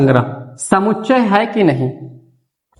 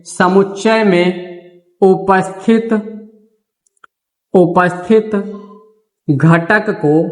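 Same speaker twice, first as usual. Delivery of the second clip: slow, word by word dictation with long pauses; teacher dictating notes slowly. Shown as a demonstration. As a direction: dictate each word so they can write it down. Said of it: "note point में यह भी लिख लेना".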